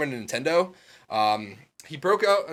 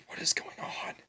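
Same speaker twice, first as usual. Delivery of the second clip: whisper; 11 dB quieter than usual. Retold or imitated. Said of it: "I was like".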